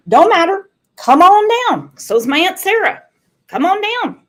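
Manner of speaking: high, loud come on down; hollered like a game show host; a big welcoming holler; game show call. Pretend. Game show style invitation.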